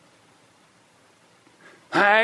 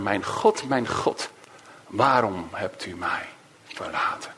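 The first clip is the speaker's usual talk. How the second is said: softer, sinking lament, a hint of despair; forsaken cry; 7 semitones lower, 5 dB quieter than usual. Preacher voicing Jesus on the cross, softer lament. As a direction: let it fall away, quietly abandoned.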